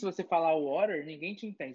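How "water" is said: "water" in an American accent. Shown as a demonstration. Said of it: "eu falo mais inglês americano".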